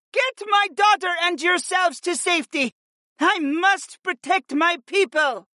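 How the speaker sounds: high, commanding cry with great strength; powerful shout. A narrator's reading. Warrior mother sending her daughter away, shouting powerfully. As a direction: shout the order with full resolve. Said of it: "The strength of her tone was so powerful".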